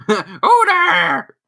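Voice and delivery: very high, loud hammed-up wail; mock startled wail. Startled Aunt May calls out to Pete, mock wail. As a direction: cry it out like a startled aunt, overdone.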